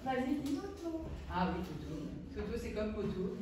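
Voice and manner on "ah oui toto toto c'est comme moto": quiet, slow pupil reply. Real, not acted. Pupil answering teacher, quietly. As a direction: pupil says it softly. Child speaking softly.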